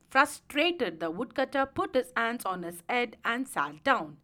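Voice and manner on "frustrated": plain reading voice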